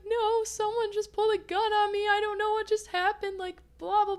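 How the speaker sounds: high, tearful mock sob; imitating sobbing. Answering the two women who stopped her, high and sobbing. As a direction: replay the panic in a high crying voice.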